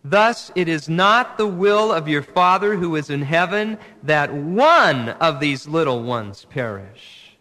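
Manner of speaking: loud, slow congregational reading; reading scripture aloud together. Real, not acted. Pastor leading the congregation in reading the verse, loud and measured. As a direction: read it out with the whole room. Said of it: "Would you read it out loud with me?".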